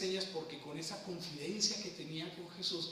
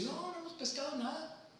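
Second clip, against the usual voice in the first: a lightly voiced fisherman, higher; disciples answering; 10 semitones higher than usual. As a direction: call back from the boat, a bit higher. Los discípulos responden desde la barca. (pescador que contesta, voz un poco más aguda)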